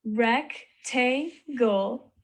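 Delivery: "Rectangle" slowly split as rec, tan, gle; three stretched, separate beats in "Rectangle"; syllable by syllable. Shown as a demonstration. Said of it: "three syllables in each of the words".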